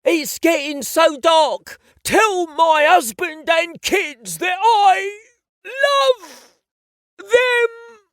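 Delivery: slow, loud, hammed-up sobbing death speech; hammy death scene. Rehearsed death speech for a little boy, hammed-up. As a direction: overact a tragic dying farewell. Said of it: "my go-to death scene speech".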